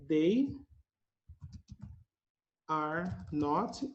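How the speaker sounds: usual speaking voice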